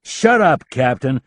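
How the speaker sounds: loud, high snap; snapped. A narrator's reading. Rapp snaps at the captain to shut up. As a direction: cut him off hard. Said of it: "Rapp snapped".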